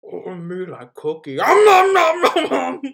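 high, loud, slow monster gobble; Cookie Monster voice. Retold or imitated. [name] mocking Kenny's intro, loud Cookie Monster noms. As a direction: ham up a greedy cookie monster. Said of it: "Kenny's doing Cookie Monster A S M R".

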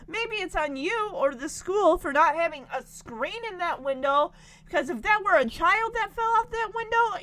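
outraged mock lecture, rising to a shout; indignant rant. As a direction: scold a careless teacher. The speaker scolds the teacher in indignation.

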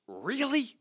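high, drawn-out disbelief; retold incredulous challenge. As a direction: question it like you can't believe their nerve. Retold confrontation with the campers.

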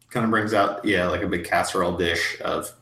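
ordinary talk, nothing marked

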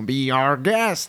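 slow, drawn-out mock-French accent; exaggerated French accent. Shown as a demonstration. Actor mocking Americans who overdo it, exaggerated French accent. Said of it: "ils accentuent beaucoup plus".

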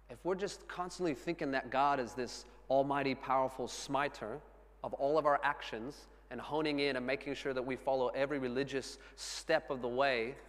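ordinary delivery

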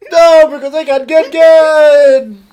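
loud, slow, drawn-out shout; belted punchline. Pretend. Shouting the punchline to the cohost, loud and slow. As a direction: belt it out like a showstopper. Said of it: "Say it again".

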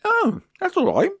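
high, delighted squeal; pleasantly surprised. Real, not acted. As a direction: squeak out your surprise at the bargain. Surprised at the low price.

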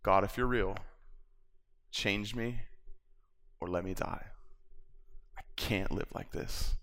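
a hushed, broken plea to God, fading to a whisper; quiet sincere prayer that sinks into a whisper; desperate quiet prayer. Retold or imitated. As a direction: pray it soft and raw, as if alone in the bathroom. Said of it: "And it sounded like this".

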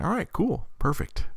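mock cheerful. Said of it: "I'm like".

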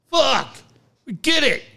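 ordinary talk, nothing marked

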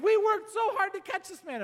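high, loud exasperated protest; indignant cop. Retold or imitated. Cop objecting to Marius freeing the thief, loud indignant protest. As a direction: throw up your hands like a frustrated officer.

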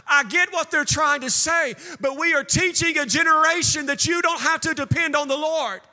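high, driving, repetitive sermon cadence; impassioned preaching. Acted. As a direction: hammer it home to the congregation. Preaches at full force.

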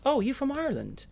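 very high "oh, you from Ireland?"; a delighted, squealing question; mock surprise. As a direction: play the amazed casting director. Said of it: "You get asked".

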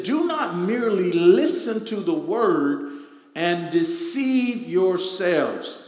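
loud, slow scripture reading; reading scripture with power. Acted. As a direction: proclaim it like the word of power. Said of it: "read that like we know it is the word of his power".

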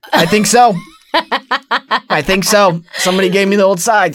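hammed-up sheepish insistence; mock-flustered reply. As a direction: protest to cover your confusion. Acts out flustered reply to casting director.

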